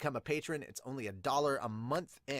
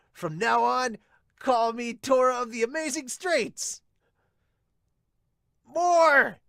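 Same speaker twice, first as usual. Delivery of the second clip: much higher and louder, showing off; boastful shout; 11 semitones higher, 10 dB louder, slower than usual. Acted. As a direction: bellow the new title like a hero. Teammate hyping a new nickname.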